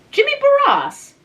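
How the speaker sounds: high, loud disbelief at the name; astonished. A narrator's reading. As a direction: leap up in disbelief. Shocked at news of engagement.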